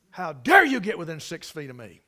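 loud offended outburst, imitating her; mock indignation. Preacher voicing the woman who recoiled from him, mock indignation. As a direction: hit DARE hard, playing affronted. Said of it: "looked at me like".

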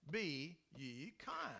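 drawn out, each word given weight; slow, weighty Be ye kind; slow scripture quote. Shown as a demonstration. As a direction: read it out like a solemn command. Preacher quoting scripture command.

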